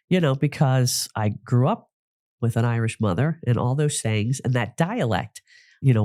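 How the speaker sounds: ordinary delivery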